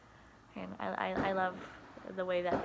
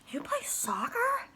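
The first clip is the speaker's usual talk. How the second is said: put-on high, slow whispered kid question; curious kid; 6 dB louder, slower than usual. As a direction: whisper it like an amazed little kid. Kids asking the teacher.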